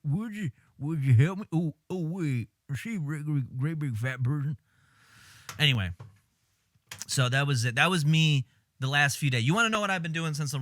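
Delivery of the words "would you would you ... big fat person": throaty movie-villain impression, badly done; halting, swallowed creep voice; Buffalo Bill. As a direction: attempt a light Southern accent from the back of the throat. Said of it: "doing very subpar Buffalo Bill impressions".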